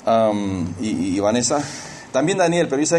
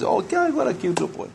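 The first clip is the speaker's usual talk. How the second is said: high, cooing mock admiration; pitched-up adoring coo; gushing admirers; 9 semitones higher than usual. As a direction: imitate someone fawning over a cute child. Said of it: "Es agradable si lo conocen cinco minutos".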